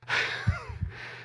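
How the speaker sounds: short airy laugh at his own joke; breathy laugh. Real, not acted. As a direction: let a soft breathy laugh escape.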